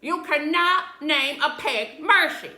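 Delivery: loud, slow indignant shout. A narrator's reading. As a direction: shout it down, outraged. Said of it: "shouted Eugenia".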